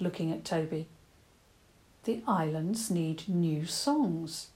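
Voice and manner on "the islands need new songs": slow and thoughtful; slowly. Acted. Toby slowly working out what the islands need. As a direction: work it out word by word. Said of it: "Toby said slowly".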